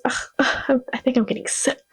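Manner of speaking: whispered feeble fake illness; faking sick. Catherine faking sickness to dodge the dinner, whispered. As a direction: groan like you're suddenly sick. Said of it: "Catherine goes".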